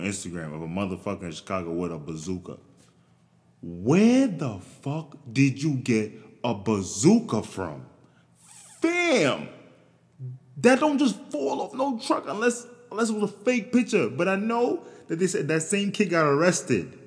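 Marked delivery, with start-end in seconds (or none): 3.6-7.8 louder, slowed outburst of disbelief; incredulous yell. Real, not acted. Disbelief at bazooka picture. 8.8-17.0 much higher, louder exasperated outcry; high, loud exasperated outburst to the audience; exasperated high exclaim. Real, not acted. Exclaiming about bazooka kid.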